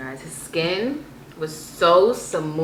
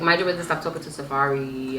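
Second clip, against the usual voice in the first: normal talking voice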